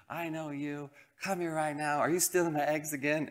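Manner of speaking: high-pitched imitation of an angry neighbor; mock scolding. Neighbor to boy stealing her eggs, mock scolding. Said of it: "she screamed at us like she should".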